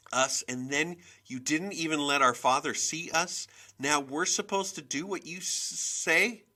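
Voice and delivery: put-on upset child working up courage to accuse; shaky, halting voice. A narrator's reading. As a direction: push the words out brokenly, then let it build. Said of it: "my voice came out a shaky whisper".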